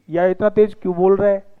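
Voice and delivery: ordinary talk, nothing marked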